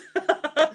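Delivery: high loud chuckle; laughing. Real, not acted. Laughing at her French, high loud giggle. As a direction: giggle on after the remark.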